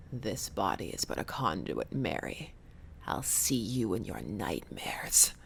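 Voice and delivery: low, slow menacing whisper; demonic whisper. Acted. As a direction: threaten her from beyond. Demon speaks through body.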